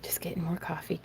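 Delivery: soft whispered aside; hushed whisper. Real, not acted. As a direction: whisper it so you don't disturb anyone. Quiet aside stepping away.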